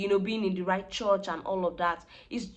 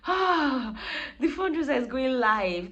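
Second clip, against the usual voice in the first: higher, louder, nervous gasp of panic; panicked inner voice; 6 semitones higher, 3 dB louder than usual. Retold or imitated. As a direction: replay the jolt of fear as it goes live. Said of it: "like, feeling".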